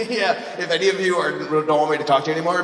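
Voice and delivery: ordinary talk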